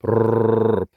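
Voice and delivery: rolling brrr call to the animals; lip trill call. Real, not acted. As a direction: trill the lips to call the mongoose. Calling the mongoose with trill.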